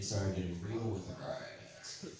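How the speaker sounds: ordinary talk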